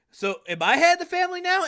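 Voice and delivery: high, fast eager question; a young son hopefully pushing his luck. Acted. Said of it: "he's like".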